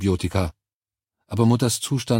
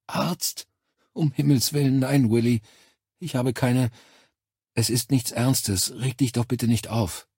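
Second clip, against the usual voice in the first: put-on high, soothing elderly mother voice; ältere Mutter, abwehrend; 5 semitones higher than usual. A narrator's reading. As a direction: wave the worry away as an ailing mother. (hohe, beschwichtigende Mutterstimme)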